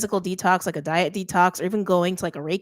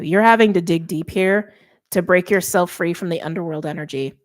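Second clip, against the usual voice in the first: ordinary talk, nothing marked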